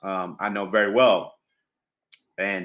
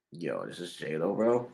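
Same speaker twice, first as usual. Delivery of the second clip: stunned hush; 6 semitones lower, 9 dB quieter, slower than usual. Retold or imitated. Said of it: "looking at the phone like".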